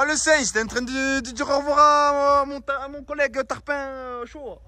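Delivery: playful southern French twang; marseille accent. Pretend. Joking banter with friend, slang Tarpin.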